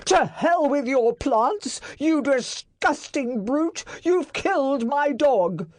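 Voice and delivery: high, loud raging accusation; shaking with rage. A narrator's reading. Master accusing Barrett of killing dog, raging. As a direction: let the rage shake through. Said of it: "his voice shaking with rage".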